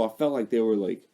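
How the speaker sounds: ordinary talk, nothing marked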